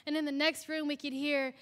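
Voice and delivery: usual speaking voice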